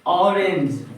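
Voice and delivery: ordinary talk, nothing marked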